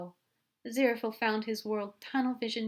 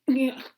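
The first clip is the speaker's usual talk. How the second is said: flustered noise; 7 semitones higher, 9 dB louder than usual. Acted. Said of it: "a very eloquent".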